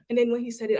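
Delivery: ordinary delivery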